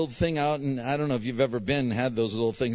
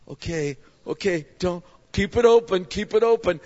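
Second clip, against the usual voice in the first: acted nervous urging, rising higher and louder; anxious self-coaching; 7 semitones higher, 6 dB louder than usual. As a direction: brace yourself, pitch climbing. Acting out bracing for eye drops.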